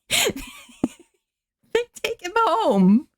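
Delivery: high, drawn-out laughing repeat. Real, not acted. Laughing at the absurd ending to her viewers. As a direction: laugh the words out slowly up high.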